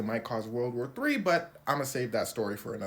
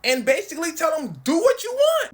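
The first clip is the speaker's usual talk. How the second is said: rising from loud to a much higher shout; building mock Trump rant; 12 semitones higher, 10 dB louder than usual. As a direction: escalate into a shrieking threat. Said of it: "He says".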